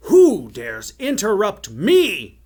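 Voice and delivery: slow, high, thundering challenge; outraged shout. A narrator's reading. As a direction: roar it slowly like an affronted tyrant. Furious enchanter rounds on interrupter.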